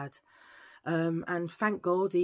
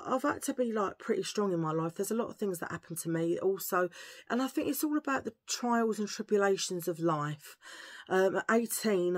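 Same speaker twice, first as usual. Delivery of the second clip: normal talking voice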